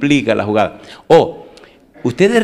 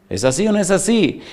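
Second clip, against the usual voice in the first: same question again, pitched higher and pressing; higher repeat; 8 semitones higher than usual. Real, not acted. Pastor pressing the congregation for agreement, higher. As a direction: push the question up to get an answer.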